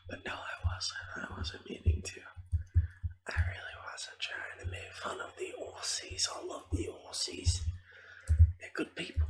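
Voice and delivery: hushed, mostly voiceless game commentary; ASMR whisper. Real, not acted. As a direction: whisper casual gameplay chatter to the listener.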